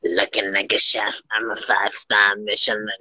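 half-mock the line like a creepy creature; light Gollum imitation. Mocking her own chef line as a mild Gollum. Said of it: "I sound like fucking Gollum".

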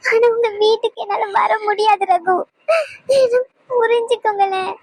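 sobbing plea; crying. Acted. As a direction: beg him through tears. Wife begs husband to understand. (அழுகையுடன் கெஞ்சல்)

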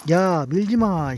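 drawn-out scold at the cats; slow loud scolding. Real, not acted. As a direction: stretch it out, scolding. (천천히 크게 혼내듯)

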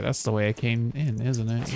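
usual speaking voice

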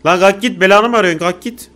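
loud, high angry yell; angry shouting. Real, not acted. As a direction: yell at it to go away. Yelling at monster to leave.

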